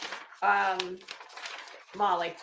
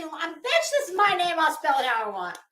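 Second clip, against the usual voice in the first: sassy retort; 6 semitones higher, 5 dB louder than usual. Retold or imitated. Retorting to the teacher.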